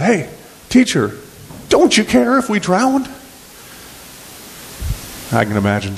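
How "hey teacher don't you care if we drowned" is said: high-pitched pleading with the sleeping teacher; alarmed disciples. Acted. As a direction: cry out in acted panic, pitch climbing. Acts out panicked disciples waking Jesus.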